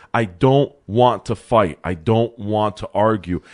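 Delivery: slow, deliberate quote; measured imitation of someone refusing; retold weary refusal. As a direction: spell it out word by word. Quotes man refusing to fight.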